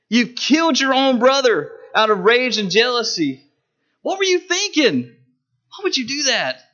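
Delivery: high, driving pulpit rebuke; a pastor rebuking Cain from the pulpit, impassioned; impassioned preaching. Acted.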